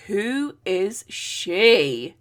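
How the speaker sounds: very slow, each word stretched; drawn-out wonder. Retold or imitated. Pwyll on his mound seeing the rider, slow awed question. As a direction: savour the question, spellbound.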